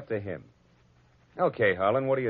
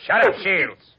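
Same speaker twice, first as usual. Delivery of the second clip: angry shout; 10 semitones higher, 8 dB louder, slower than usual. Acted. Gangster shouts down Shields to shut him up, loud and angry. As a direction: snap it out loud and high. Thug silences Shields mid-accusation.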